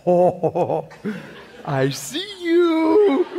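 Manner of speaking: very high, drawn-out peekaboo call; playful singsong. Acted. Preacher acting out spotting God in his truck mirror, playful singsong. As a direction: sing it out slowly like hide-and-seek.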